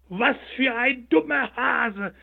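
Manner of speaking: high, slow, mocking chorus voice; proud sunflowers. A narrator's reading. The proud sunflowers jeer at the hare, high and slow. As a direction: sneer at the silly hare. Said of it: "riefen die stolzen Sonnenblumen".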